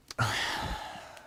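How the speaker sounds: long breathy sigh; airy, voiceless exhale; settling sigh. Acted. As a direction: let out a long sigh on sitting. Settling into his seat.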